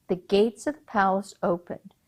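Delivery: ordinary delivery, nothing marked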